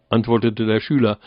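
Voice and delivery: ordinary delivery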